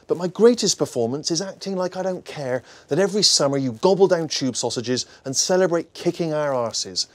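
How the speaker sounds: usual speaking voice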